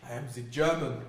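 loud put-on German accent. Answering co-host's request, loud German accent. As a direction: declare it loudly in mock German. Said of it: "Can you do German?".

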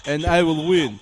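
loud Russian boxer rival taunt; Ivan Drago voice. Retold or imitated. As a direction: boom it out like a cocky champion. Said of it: "You've seen Rocky Three".

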